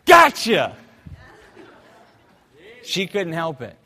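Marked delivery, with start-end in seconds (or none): 0.1-0.8 loud, high gotcha; triumphant shout. Acted. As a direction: shout it like catching her at last. Catching the mother at the van.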